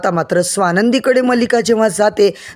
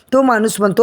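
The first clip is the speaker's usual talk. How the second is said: ordinary talk, nothing marked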